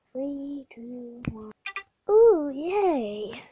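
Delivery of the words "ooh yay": loud delighted yay; excited cheer. Pretend. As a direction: cheer at the magic trick. Snack magically appears.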